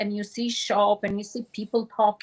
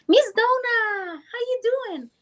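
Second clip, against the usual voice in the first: put-on young girl's greeting; 13 semitones higher, 3 dB louder than usual. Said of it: "and she said".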